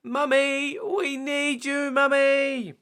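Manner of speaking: put-on high, slow child's call up the stairs; shouting. Peppa shouting from bed for Mummy, high and slow. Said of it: "Peppa shout".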